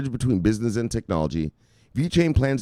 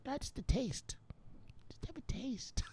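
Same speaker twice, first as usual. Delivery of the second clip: soft, higher whispered coaxing; tempting whisper; 13 semitones higher, 18 dB quieter than usual. Acted. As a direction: coax them in a whisper. Dealer tempting softly.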